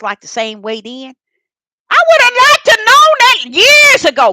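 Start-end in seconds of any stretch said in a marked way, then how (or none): 1.9-4.3 much higher and louder, bursting out; loud exclaiming. Real, not acted. Telling her listeners what she wished she'd known, exclaimed loudly. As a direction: cry it out in frustration.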